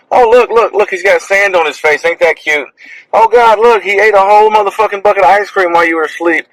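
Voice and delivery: high-pitched mock excitement over baby pictures; mock gushing parent. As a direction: gush like a parent showing off photos, mockingly. Mimicking parents showing off photos.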